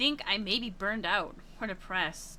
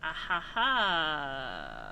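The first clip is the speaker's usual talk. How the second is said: slow low laugh; 5 semitones lower, much slower than usual. Acted. Game character's dark laugh.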